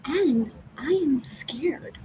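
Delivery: breathy frightened whisper; scared whisper. Real, not acted. Telling viewers of her fear in a scared whisper. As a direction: whisper the fear, barely voiced.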